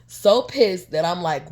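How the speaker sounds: normal talking voice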